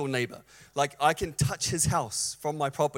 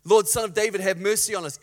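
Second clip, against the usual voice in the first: raised, insistent cry for mercy; higher, forceful plea; louder retold shout; 3 semitones higher, 7 dB louder than usual. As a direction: imitate calling out over a crowd, louder. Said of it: "They start shouting all the louder".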